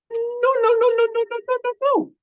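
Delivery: very high tiny rabbit voice, quick no's; a little rabbit squeaking no over and over; squeaky rabbit. Acted. Rabbit squeaking no at the jaguar, very high.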